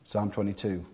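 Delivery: ordinary talk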